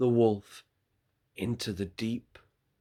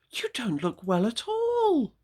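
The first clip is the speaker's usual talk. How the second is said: put-on worried little girl; 13 semitones higher, 3 dB louder than usual. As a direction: stretch out a high worried "Oh". Girl to Grandmother.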